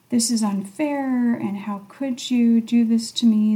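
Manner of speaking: normal talking voice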